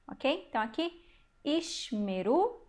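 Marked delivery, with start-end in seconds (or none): 1.4-2.6 ishmeru drawn out slowly syllable by syllable; teacher spelling out ishmeru slowly; slow model word. Shown as a demonstration. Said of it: "a regra básica é essa".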